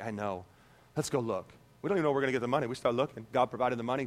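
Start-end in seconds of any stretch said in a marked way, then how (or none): 0.0-1.5 very low, soft reply; retold calm murmur; quietly unfazed husband. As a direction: answer the worried wife low and quiet. Retold husband calmly answering wife.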